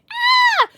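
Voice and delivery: loud, very high shriek; short piercing happy scream; high squeal. Real, not acted. Squeal after forehead kiss from dog.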